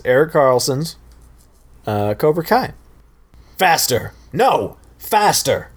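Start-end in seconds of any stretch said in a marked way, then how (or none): 3.6-5.7 sharp rising shouts at the pupil; sensei yelling drills. Acted. Karate sensei urging his student to punch faster, shouting. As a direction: bark it like a harsh coach.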